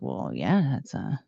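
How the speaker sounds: a low goofy well yeah; deep mock voice. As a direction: drop the voice way down like a slow dimwit. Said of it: "I was like".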